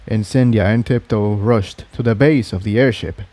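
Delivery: usual speaking voice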